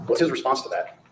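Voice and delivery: ordinary talk, nothing marked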